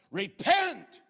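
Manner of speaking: "repent." thundered high and loud; shouted call. Acted. Preacher calling the child of God to repent, shouting. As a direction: blast "repent." out as a command.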